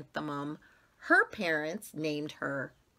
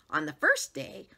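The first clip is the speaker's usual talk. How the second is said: usual speaking voice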